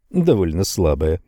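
plain reading voice

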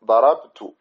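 ضربت very slow, stressing the unvowelled ب; teacher insisting on the right ضربت, slowly; correct form. Shown as a demonstration. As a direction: stretch ضربت, hold the still ب. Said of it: "لا، ضربت".

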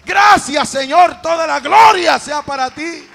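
loud, fervent pulpit praise; shouted praise. Real, not acted. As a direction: build from loud call to full shout. Calls congregation to praise God.

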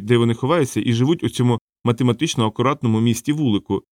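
plain reading voice

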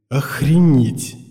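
stunned slow outburst; shocked exclamation. A narrator's reading. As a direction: blurt it out in shock. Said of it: "шокированно восклицаю я мысленно". (шокированный возглас)

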